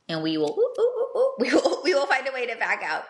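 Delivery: high laughing oohs; laughing mock recoil from a bad approach. Real, not acted. Laughs acting out backing away.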